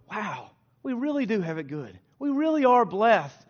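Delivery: grateful wonder. Acted. Preacher voicing a nation counting blessings, grateful wonder. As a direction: marvel at how good we have it. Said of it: "we just go".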